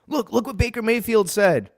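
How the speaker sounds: high, excited mock fan voice; hammed-up fan hyping the drama; mocking hype voice. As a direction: parrot the drama-loving fans, mocking. Mocking fans hyping Baker drama.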